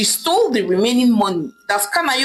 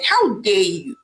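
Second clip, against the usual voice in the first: plain reading voice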